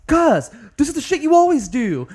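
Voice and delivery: high, loud mock-distraught yelling; imitated screaming. Podcaster acting out the unhappy woman chasing a man, screaming. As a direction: yell it like a fed-up, wailing woman. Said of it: "was screaming".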